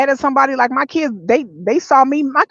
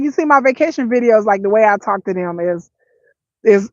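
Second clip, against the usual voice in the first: ordinary talk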